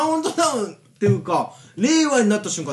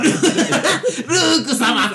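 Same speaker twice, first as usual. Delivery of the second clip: drawn-out call; 6 semitones higher, much slower than usual. Acted.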